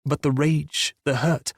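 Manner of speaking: plain reading voice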